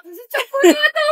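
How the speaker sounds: laughing. Real, not acted. Joking about crying over champorado.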